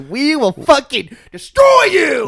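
roaring, high and loud threat; furious shout. Acted. Host yelling a challenge at the worst driver. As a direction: blow up at him full force.

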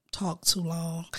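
ordinary talk

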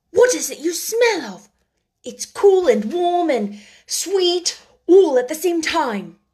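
lightly voiced excited boy; boy marvelling at a scent; delighted boy. A narrator's reading. Colin asking, delighted.

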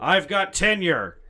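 gruff professor boast; Indiana Jones impression. Shown as a demonstration. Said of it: "Just the actual Indiana Jones".

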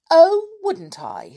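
high, defiant doll voice; cheeky doll. A narrator's reading. Doll Amelia Jane defying the bear, high and cheeky. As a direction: toss it back, daring them. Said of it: "said Amelia".

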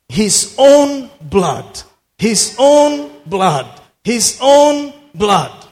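"own" drawn out high and loud; emphatic repetition. Real, not acted. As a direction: hammer "own" slowly, then land on "blood". Hammers a repeated scripture phrase.

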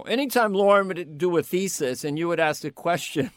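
normal talking voice

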